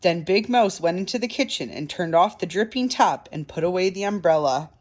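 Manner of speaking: plain reading voice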